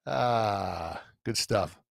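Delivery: getting teary after thanks; choked up. Real, not acted. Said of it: "Get me all choked up".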